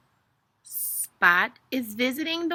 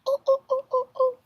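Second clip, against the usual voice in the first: high-pitched monkey oohs; playful high monkey call; monkey hooting; 13 semitones higher, much faster than usual. Shown as a demonstration. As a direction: hoot like a monkey in short bursts. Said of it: "What sound does a monkey make".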